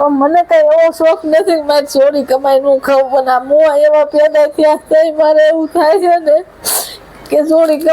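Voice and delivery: tearful, pitch up as she breaks down; crying. Acted. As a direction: speak through rising sobs. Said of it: "રોવાનું બંધ કરો તમે".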